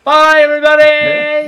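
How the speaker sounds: high, loud, drawn-out farewell; exaggerated goodbye. Real, not acted. Exaggerated sign-off to listeners.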